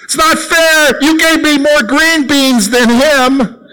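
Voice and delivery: put-on high, slow whining kid voice; whiny child. Boy complaining to his mom at dinner, high whine. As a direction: whine it like a little boy at the table. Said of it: "here's a better one".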